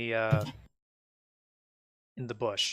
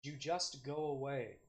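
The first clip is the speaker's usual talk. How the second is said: muttering; 13 dB quieter, slower than usual. Acted. Game master voices a distant traveler overheard in the forest, muttering. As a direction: mutter it slowly under the breath. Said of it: "you hear a muttering of something".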